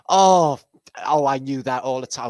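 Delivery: high loud knowing gush; hammed-up high delight; mock fan gush. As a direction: gush that you knew it all along.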